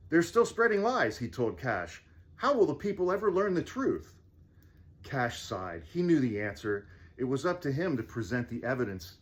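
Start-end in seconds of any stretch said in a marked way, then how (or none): 0.1-4.1 higher, worried king voice; a distressed king pleading; upset king voice. Acted. Sad king speaks.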